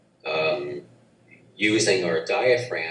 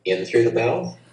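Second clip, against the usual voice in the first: guided breath cues; faster than usual. Shown as a demonstration. Teacher restarting the breath cycle with the host, calm guiding voice. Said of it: "So starting again".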